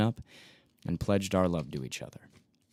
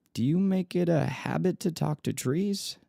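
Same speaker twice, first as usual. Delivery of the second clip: playful teasing question; 9 semitones higher than usual. Acted. Narrator teasing the woman by tree.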